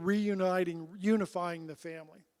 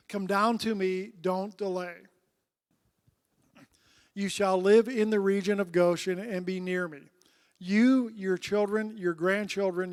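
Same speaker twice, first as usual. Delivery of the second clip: louder, measured Bible reading to the class; solemn scripture read to the congregation; 5 dB louder than usual. Acted. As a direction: read the passage out with weight.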